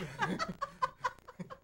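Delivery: high, soft burst of laughter; real amused laugh. Real, not acted. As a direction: laugh at your own overacting. Laughs at own overdone demo.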